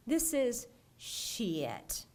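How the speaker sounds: shit stretched slow and long, Southern style; Southern drawled shit. Shown as a demonstration. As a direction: drag out "shit" like a Southern grandma. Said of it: "Think of what's done to shit in the South".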